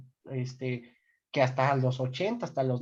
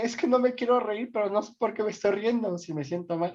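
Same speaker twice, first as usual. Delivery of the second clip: mock distressed protest; 6 semitones higher, faster than usual. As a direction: act out a flustered confession, easing off at the end. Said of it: "hay veces que uno dice".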